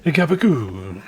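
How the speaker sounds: hammed-up Italian accent, called out loud; loud mock Italian greeting. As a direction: shout it like a cartoon Italian mobster. Greets the NPC again, louder.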